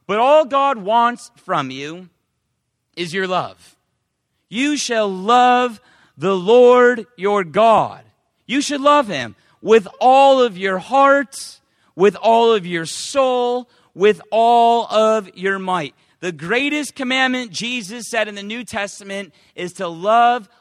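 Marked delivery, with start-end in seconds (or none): 4.5-15.9 loud, solemn reading of holy words; loud preacher reading scripture. Acted. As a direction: proclaim the verse to the congregation. Reading scripture aloud in sermon.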